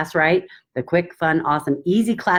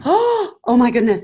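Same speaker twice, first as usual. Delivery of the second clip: much higher, louder "oh my goodness"; imitating excited gasp; 8 semitones higher, 5 dB louder than usual. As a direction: play a thrilled new member.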